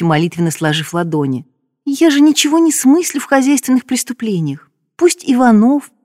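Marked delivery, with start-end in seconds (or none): 1.9-5.9 high whiny pleading; whiny tattling little sibling. A narrator's reading. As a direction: whine like a kid passing the blame. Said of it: "заныла Ирина".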